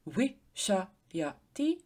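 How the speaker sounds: wishayati drawn out slowly, each syllable wi-sha-ya-ti separate. Shown as a demonstration. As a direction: spell out wishayati slowly so wi stands alone. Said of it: "The first syllable would be wi".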